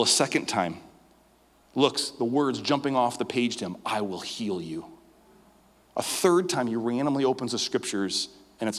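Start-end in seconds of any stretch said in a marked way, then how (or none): 3.8-4.9 slow, much softer, awed; hushed revelation. Retold or imitated. Ren reading the verse off the page, slow hushed awe. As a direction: breathe it like a holy word.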